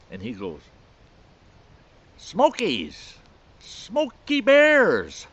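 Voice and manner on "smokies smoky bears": loud high holler, last word stretched way out; Speedy. Retold or imitated. Bear hunter telling how he eats bear meat, high drawn-out holler. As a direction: crow it like an old mountain man, building louder. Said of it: "just the way that old boy said that".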